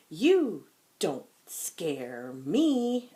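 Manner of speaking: slow, loud defiant line. Shown as a demonstration. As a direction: say it firmly to the monster. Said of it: "We have to say it lound".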